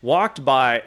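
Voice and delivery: ordinary talk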